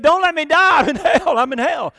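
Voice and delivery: loud, high-pitched desperate cry; acted yelling. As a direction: scream it out like a patient begging for rescue. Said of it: "that's what he yelled".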